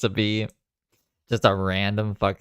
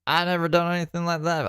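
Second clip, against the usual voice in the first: much higher, lightly mocking voice; a hint of faked innocence, pitched up; teasing, squeaky mock protest; mock innocent denial; 10 semitones higher than usual. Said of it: "if she's ever like".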